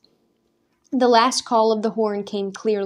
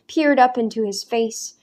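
plain reading voice

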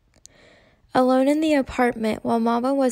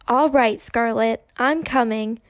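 plain reading voice

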